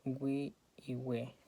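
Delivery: "wi" and "we" with the U sounded as a w glide; "wi", "we", slow and separate; pronounced U. Shown as a demonstration. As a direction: say "wi y we" slowly, sounding each U. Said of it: "la U debe ser pronunciada".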